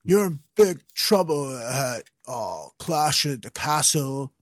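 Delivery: mock Matt Riddle trash talk, slow and lazy; Riddle impression. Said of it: "Matt Riddle coming to the ring and being like".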